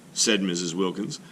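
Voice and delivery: ordinary delivery, nothing marked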